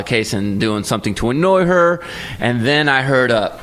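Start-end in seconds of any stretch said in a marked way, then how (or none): none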